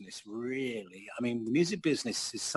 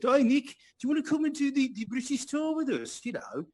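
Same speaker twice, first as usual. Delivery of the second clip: high, fast imitation of a Wolverhampton accent; 13 semitones higher than usual. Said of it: "That's a Wolverhampton accent".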